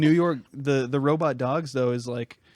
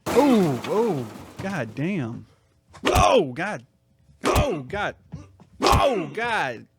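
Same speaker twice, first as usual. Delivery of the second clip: shocked groan; much slower than usual. Real, not acted. Reacting to a dog fight.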